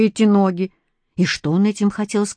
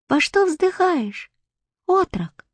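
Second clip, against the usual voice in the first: put-on much higher, slow gentle boy's voice; tender quiet voice; 11 semitones higher, slower than usual. A narrator's reading. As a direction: ask softly like a small tsarevich. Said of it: "нежным тихим голосом". (нежно и тихо, высоким детским голосом)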